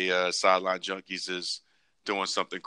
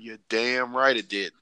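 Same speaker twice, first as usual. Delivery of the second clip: ordinary talk, nothing marked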